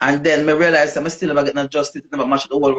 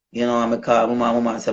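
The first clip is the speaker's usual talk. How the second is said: ordinary delivery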